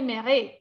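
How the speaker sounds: MÈRE drawn into two syllables, me-RE; mispronounced silent E. Shown as a demonstration. Teacher showing what she didn't say, MÈRE with final E sounded. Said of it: "Yo no dije mère".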